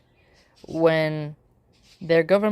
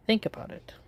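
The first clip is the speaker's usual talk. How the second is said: soft whispered aside, higher; 4 semitones higher, 6 dB quieter, faster than usual. Shown as a demonstration. Urging viewers to reflect, whispered. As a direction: breathe it to the viewer like a secret. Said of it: "unvoiced whisper".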